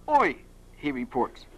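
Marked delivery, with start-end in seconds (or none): 0.1-0.4 higher-pitched call of "Oi"; restrained storyteller's shout; mild shout. Acted. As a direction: call out as if hailing someone, without full volume. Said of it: "I heard someone shout".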